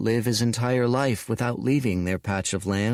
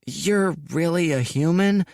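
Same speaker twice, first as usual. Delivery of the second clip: hesitant; 8 semitones higher than usual. Acted. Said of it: "said the boy hesitantly".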